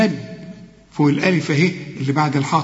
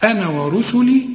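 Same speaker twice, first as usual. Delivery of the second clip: Quran recitation; 5 semitones higher than usual. Shown as a demonstration. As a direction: recite with long measured vowels.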